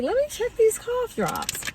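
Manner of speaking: put-on very high, puzzled old-lady voice; sweet old lady. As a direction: ask it high, imitating a sweet old woman. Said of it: "little sweet old lady".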